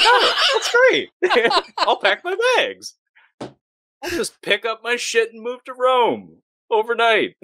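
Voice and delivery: very high, gleeful parody; mock instant cheer. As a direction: gush with put-on delight. Mocks actress's instant cheerfulness on phone.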